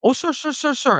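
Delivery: high, pleading mock cabbie without the accent; cab driver pleading. Retelling the cabbie calming him, high and pleading. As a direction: plead sir sir like the flustered driver. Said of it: "and I'm not gonna do the accent".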